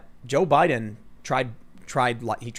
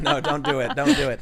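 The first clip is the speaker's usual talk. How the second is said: mock alarm; 13 semitones higher than usual. Backpedalling on the joke.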